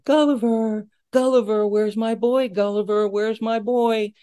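high singsong call to a pet. Retold or imitated. Visitor walks into the enclosure years later, singsong calling.

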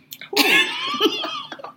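high-pitched disbelieving laugh. Real, not acted. Woman recalling being hung up on, high laugh. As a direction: laugh at how absurd it was.

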